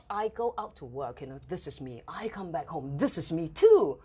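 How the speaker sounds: usual speaking voice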